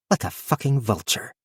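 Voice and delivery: soft bitter mutter under her breath. Acted. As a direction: grumble it quietly to herself. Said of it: "she muttered".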